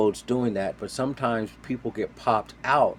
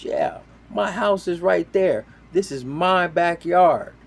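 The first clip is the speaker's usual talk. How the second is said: high, loud mock indignation; raised, insistent voice of an irate landowner; imitated indignant landowner; 9 semitones higher, 5 dB louder than usual. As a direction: imitate a woman staking her claim. Landowner confronts boaters.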